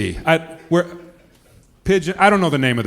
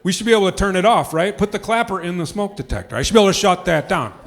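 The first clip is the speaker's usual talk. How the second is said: normal talking voice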